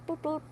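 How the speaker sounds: high, soft little toots; horn toot imitation. Shown as a demonstration.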